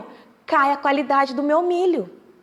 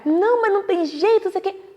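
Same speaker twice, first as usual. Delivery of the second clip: higher, rushed, flustered protest; a rushed, higher mock panic; 8 semitones higher, much faster than usual. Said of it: "tá apavoradinho, que nem eu".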